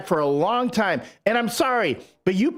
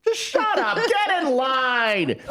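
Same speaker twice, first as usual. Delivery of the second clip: high, exasperated, stretched-out scolding; scornful dismissal; 6 semitones higher, slower than usual. Real, not acted. Bears fan telling whining Packers fans off, scornfully. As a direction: cut off the whiners in a high voice.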